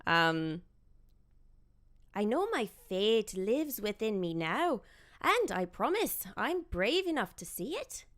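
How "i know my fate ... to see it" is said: light Scottish Merida voice, read out; a mild attempt at Merida's brogue; rusty Scottish lilt; Scottish accent. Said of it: "I haven't done an accent since the event".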